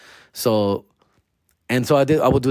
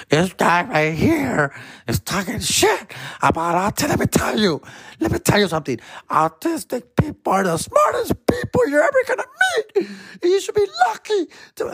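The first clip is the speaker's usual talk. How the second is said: very high, teary mock drunk woman; drunk crying lady; 13 semitones higher than usual. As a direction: wail it like a sobbing drunk mom. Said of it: "security had to come".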